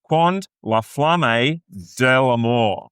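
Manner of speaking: slow, careful French accent on a song title; best French accent. Shown as a demonstration. Host reading the aria title in her best French accent. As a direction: reach for French vowels, slowly. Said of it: "I'm gonna say with my best French accent".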